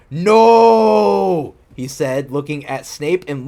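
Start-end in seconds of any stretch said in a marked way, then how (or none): 0.1-1.5 long, loud, high "No"; hammed-up dramatic shout. Reader acting the character's protest at Snape and Lupin, hammed-up shout. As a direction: draw out a theatrical cry of refusal.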